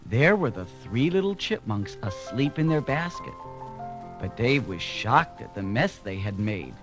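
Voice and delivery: usual speaking voice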